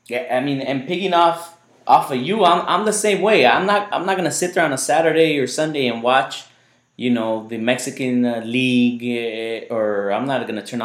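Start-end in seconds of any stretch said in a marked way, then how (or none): none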